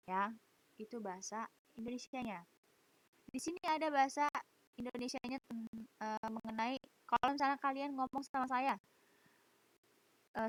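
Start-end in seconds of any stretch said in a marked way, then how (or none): none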